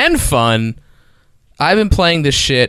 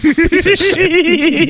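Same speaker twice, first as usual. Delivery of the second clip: laughing insult; 3 dB louder, much slower than usual. Real, not acted. Ranting at friend's bit.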